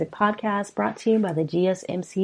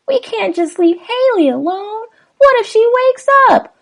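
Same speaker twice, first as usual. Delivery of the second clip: voiced frightened wife; 7 dB louder than usual. Host reading lyrics gives the wife a high, frightened plea. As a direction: pitch way up as the scared wife.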